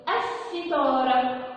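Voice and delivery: "الستارة" slowly, lam silent, doubled s. Shown as a demonstration. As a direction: glide from the a straight into a long s in "الستارة". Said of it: "خلتني ما اسمعش صوت اللام". ("الستارة" ببطء، اللام ساكتة والسين مشددة)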